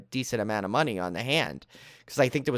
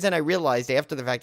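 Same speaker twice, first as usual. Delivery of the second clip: ordinary talk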